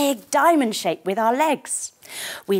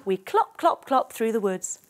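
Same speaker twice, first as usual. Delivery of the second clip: plain reading voice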